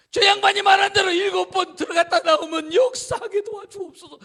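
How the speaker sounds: loud, high pleading cry to God; desperate prayer. Acted. Servant praying behind Naaman, desperate loud plea.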